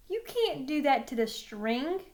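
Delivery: ordinary delivery, nothing marked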